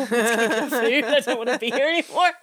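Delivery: high, loud, rushed mock panic breaking into laughter; hammed-up urge to flee through laughs; mock panicked urgency. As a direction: act out a comically desperate escape, laughing. Acting out friend fleeing the ghost.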